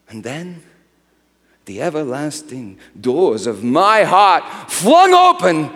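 loud, high shouting proclamation; triumphant preaching. Acted. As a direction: proclaim the glory with all your breath. Climactic proclamation of God's glory.

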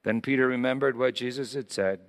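usual speaking voice

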